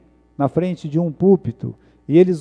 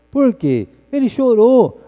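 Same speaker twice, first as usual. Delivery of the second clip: ordinary talk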